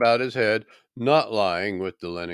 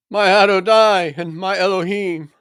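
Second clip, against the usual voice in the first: loud, high cry of faith; awed exclamation; 10 semitones higher, 8 dB louder than usual. Acted. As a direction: cry out in sudden recognition. Doubter recognises his risen Lord.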